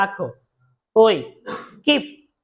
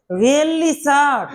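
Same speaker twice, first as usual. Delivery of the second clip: surprised; 3 semitones higher, much slower than usual. Acted. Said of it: "সত্যি অবাক হয়ে যাও আর কি".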